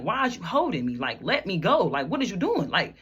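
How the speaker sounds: imitated upset protest, building to a high pitch; retold screaming protest. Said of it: "Now I'm really screaming".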